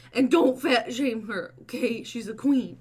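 loud, firm telling-off; loud scolding. Real, not acted. Owner scolding chat for fat shaming, loud.

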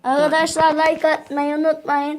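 loud upbeat sign-off; cheerful channel outro; youtuber outro. Acted. Vlog kapanış anonsu. (enerjik kapanış sesi)

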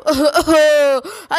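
gloating laugh; mock the victims with a laugh; villain laugh. The thief laughs at the family he robbed, gloating.

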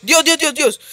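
high, loud startled yelps; alarmed outcry. Real, not acted. Player hit by a zombie, high alarmed cries. Zombie hits him during the game.

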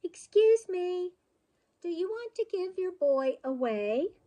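high-pitched courteous request; polite call. A narrator's reading. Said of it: "called out politely".

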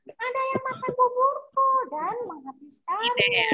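high little bear voice; squeaky baby bear complaint. Acted. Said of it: "kata Baby Bear".

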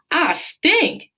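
high bellow of disgust. Acted. Bate on the porch, bellowing at the stink. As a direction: roar out at the stench. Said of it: "Bate bellowed".

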